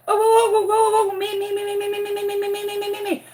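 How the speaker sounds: high, rapid pick-me chant; eager kids. Retold or imitated. Imitating classmates begging the teacher to pick them, high and eager. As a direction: mimic kids bouncing to be called on.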